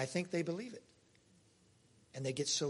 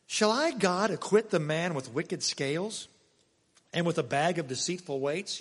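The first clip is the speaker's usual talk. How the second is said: forceful scripture reading; 2 semitones higher, 5 dB louder than usual. Acted. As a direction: proclaim the verse like a prophet.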